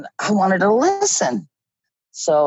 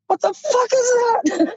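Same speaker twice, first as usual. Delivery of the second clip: shrieked in high-pitched alarm; a frightened high cry of disbelief; startled cry. Retold or imitated. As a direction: yelp it out, spooked by the noise in the dark. Startled by unearthly sound.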